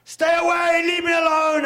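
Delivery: full-throated panicked yell; desperate shout. Retold or imitated. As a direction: scream it to drive back a charging lion. Yelling at a charging lioness.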